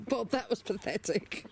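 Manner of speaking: high laugh into teasing words. Real, not acted. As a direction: laugh at the bird, then tease it. Laughing at chicken Bob.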